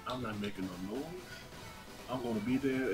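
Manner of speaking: usual speaking voice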